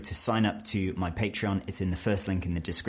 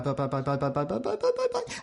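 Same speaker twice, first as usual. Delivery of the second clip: byes climbing to a much higher pitch; rising bye chain; 6 semitones higher, faster than usual. Shown as a demonstration. Explaining British goodbyes, byes rising very high. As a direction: let each bye go up until it squeaks. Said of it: "the pitch of your voice goes up".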